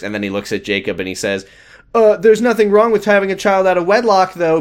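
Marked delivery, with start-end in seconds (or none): 1.9-4.6 high, fussy backtracking; hurried correction, pitched up; mock fatherly. Retelling George correcting himself to Jacob, high and fussy.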